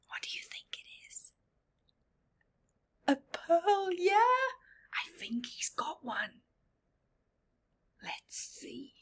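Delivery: high, hushed whisper; secretive whisper to a child; excited whisper. Acted. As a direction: whisper with building suspense. Whispering as the oyster opens.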